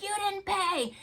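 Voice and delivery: high, loud mock yell at the customer; retold yell. Walmart worker yelling at the old man, high loud yell. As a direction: bark it out like an accusation. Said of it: "he's yelling at him".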